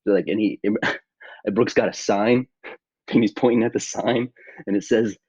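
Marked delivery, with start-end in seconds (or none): none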